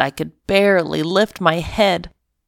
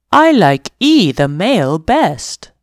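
lightly voiced dog Lance; a hint of a proud dog; 2 semitones higher than usual. Lance tells the kitten his favourite human, light dog voice. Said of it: "Lance said".

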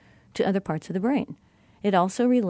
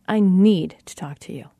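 "need" punched loud and long; stress on NEED; 6 dB louder than usual. Shown as a demonstration. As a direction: hit the word "need" hard, then ease off. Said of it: "feel the difference in that inflection".

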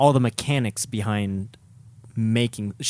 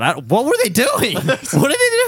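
laughing disbelief; 12 semitones higher, 4 dB louder than usual. Real, not acted. Laughing disbelief at track count.

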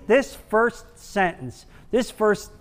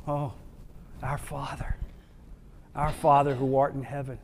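hushed whisper of our Father; a soft intimate whisper; whispered prayer; 4 semitones lower, 12 dB quieter than usual. Acted. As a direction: breathe the words softly, reverent.

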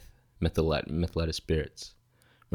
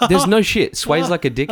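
excited; 9 semitones higher, 7 dB louder than usual. Real, not acted. Excited retelling of film scene.

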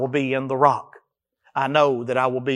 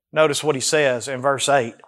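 ordinary talk

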